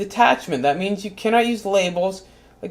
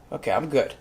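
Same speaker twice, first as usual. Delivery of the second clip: low, soft, collecting himself; quiet self-soothing; calming down; 10 semitones lower, 11 dB quieter, faster than usual. Acted. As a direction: pull it back to calm. Calming down after outburst.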